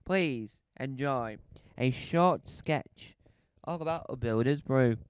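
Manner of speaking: ordinary talk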